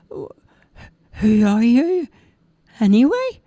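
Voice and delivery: put-on high childish voice, slow and tearful; young boy asking through tears. Roger asks the tiny man, high and tearful.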